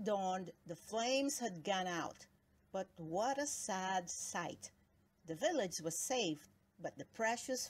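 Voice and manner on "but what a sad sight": usual speaking voice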